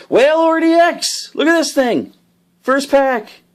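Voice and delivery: thrilled high, loud exclamation; excited. Real, not acted. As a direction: shout it out, thrilled by a lucky pull. Pulls rare card in first pack.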